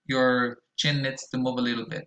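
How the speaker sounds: normal talking voice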